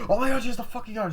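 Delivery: high-pitched panicked cry, acted out; mock alarm. Girl spotting the gun, high alarmed outcry. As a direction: blurt it out in acted shock. Retold shock at seeing a gun.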